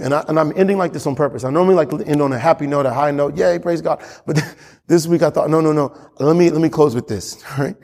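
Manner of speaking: ordinary talk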